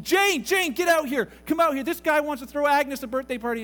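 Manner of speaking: high, loud holler into the next room; yelling to his wife. Retold or imitated. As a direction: call her out with an excited yell. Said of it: "He yells to his wife".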